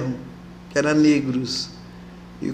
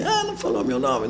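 mock complaint; 5 dB quieter than usual. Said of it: "que tiver ouvindo aí".

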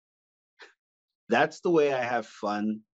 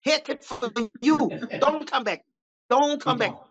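much higher mock Chinese accent, scolding; 11 semitones higher than usual. Restaurant owner banning a customer who eats too much, higher mock accent. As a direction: bark like an angry restaurant owner. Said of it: "from a Chinese food restaurant".

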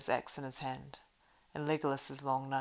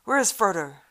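loud urgent shout, higher; crying out; 5 semitones higher, 17 dB louder than usual. Acted. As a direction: shout the questions in alarm. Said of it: "cried Gimli".

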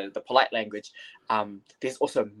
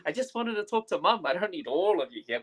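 much higher, playful exasperated shoo-away; mock exasperated; 9 semitones higher than usual. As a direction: tease the family to get off the call. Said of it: "I'm like".

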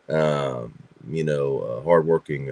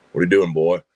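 imitating a Georgia grandfather, louder; Sam Elliott; 6 dB louder, faster than usual. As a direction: growl it like a gruff cowboy grandpa. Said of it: "kinda like, uh, Sam Elliott".

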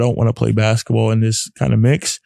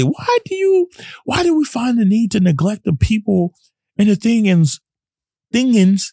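pleading rant; 9 semitones higher than usual. Retold or imitated. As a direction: beg your own mind to stop, voice cracking high. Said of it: "the real me, told my mind bully".

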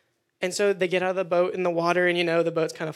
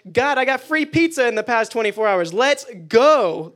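louder and higher, thrilled over free pizza; excited cheer; 4 semitones higher, 7 dB louder than usual. Retold or imitated. Preacher acting out his own prayer journal, excited and loud. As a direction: whoop it up like a win.

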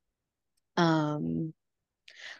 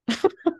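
short high chuckle; quick high-pitched laugh; 12 semitones higher than usual. Real, not acted. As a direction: let out a real, high little laugh. Laughs after "went to lunch.

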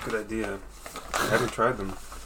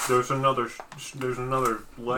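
talking with a mouth full; faster than usual. Real, not acted. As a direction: speak through a mouthful of food. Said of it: "Sorry, I'm eating".

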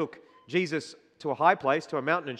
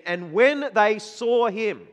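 slow scripture reading; 4 semitones higher, 5 dB louder, slower than usual. Acted. Reading verse slowly, emphatic.

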